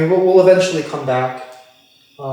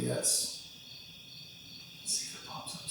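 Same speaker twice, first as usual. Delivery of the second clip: whispering; 22 dB quieter than usual. Real, not acted. Whispering while waiting for detection.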